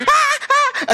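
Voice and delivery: very high shrill mock screams; mock panicked screaming. Bystander screaming at a fire, mock panicked screaming. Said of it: "pag may binubugbog".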